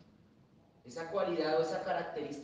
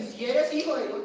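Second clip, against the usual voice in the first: loud, higher baiting dare; mocking challenge; 5 semitones higher, 7 dB louder than usual. Preacher imitates the devil's dare, loud and higher. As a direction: throw down the dare. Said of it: "El que tiene la costumbre de decir".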